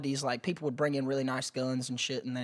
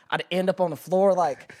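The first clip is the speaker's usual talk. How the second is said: normal talking voice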